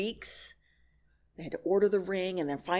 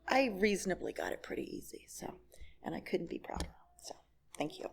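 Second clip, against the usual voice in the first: soft close; 3 semitones lower, 11 dB quieter, faster than usual. Real, not acted. Closing remark, softly.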